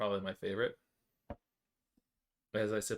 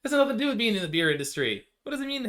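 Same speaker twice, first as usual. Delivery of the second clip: high, loud, stressed nothing; heated protest; indignant; 11 semitones higher, 6 dB louder than usual. Real, not acted. Heated defense in playful argument.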